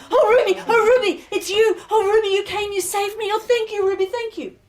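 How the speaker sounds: high, breathless rush of thanks; overjoyed gratitude. Acted. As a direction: gush with relief at being saved. Revived bride thanks her rescuer.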